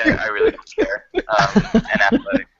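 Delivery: high, laughing delivery. Real, not acted. Game master gives up on the ruling, laughing.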